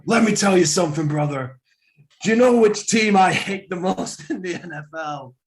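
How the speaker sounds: loud wrestling promo shout; Hulk Hogan wrestling promo. Pretend. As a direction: yell it like a pro wrestler. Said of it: "give us your best Macho Man impression".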